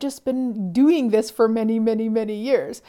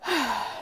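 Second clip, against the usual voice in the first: soft, released sigh; demonstrated sigh; 2 semitones higher, 6 dB quieter than usual. Shown as a demonstration. Speaker showing her habitual release sigh. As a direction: let a breath out in a sigh. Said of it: "this sighing thing that I do".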